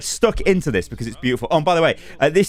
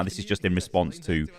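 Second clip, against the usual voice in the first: ordinary delivery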